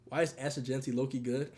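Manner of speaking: ordinary talk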